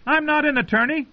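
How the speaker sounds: very high-pitched called-out protest; shouted down. A narrator's reading. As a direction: call your objection down from above. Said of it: "he shouted down".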